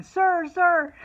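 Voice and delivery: high, loud calling out, imitating daughter; imitated yell. Daughter calling a stranger for help, imitated high yell. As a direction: yell it like flagging someone down. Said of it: "she's yelling".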